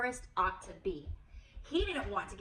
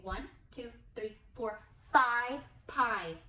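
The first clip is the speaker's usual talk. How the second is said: slow counting; 4 dB louder than usual. Shown as a demonstration. Counting pies slowly.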